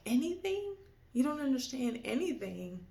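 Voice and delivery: high, incredulous question; stunned disbelief. Retold or imitated. Daughter shocked her father understands nothing, incredulous. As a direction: throw the word back in disbelief.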